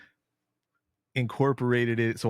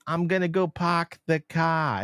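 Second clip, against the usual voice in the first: mock Boston accent; 7 semitones higher than usual. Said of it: "doesn't sound anything like what a person from Boston sounds like".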